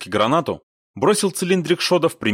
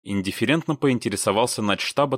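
usual speaking voice

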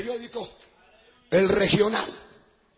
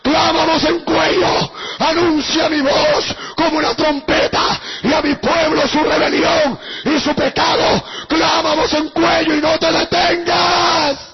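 loud, drawn-out preacher's cry; preaching at full force; 3 semitones higher, 9 dB louder, slower than usual. Acted. Preacher shouts God's command to the congregation, full-force cry. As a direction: cry it out like a trumpet, slowly.

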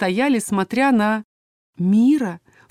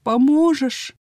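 ingratiating; 6 semitones higher, much slower than usual. A narrator's reading. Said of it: "тихо-тихо, заискивающе спросила".

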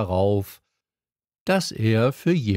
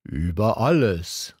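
calm, certain royal voice; king answering with clarity; king, with great clarity. A narrator's reading. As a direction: state it as plain fact. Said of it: "mit großer Klarheit".

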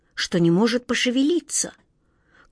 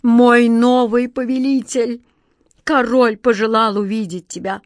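rumbling genie; 2 semitones higher, 5 dB louder than usual. A narrator's reading. Genie summons Katya to the king, rumbling. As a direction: announce it grandly, like a giant servant. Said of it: "густым голосом пророкотал Джин".

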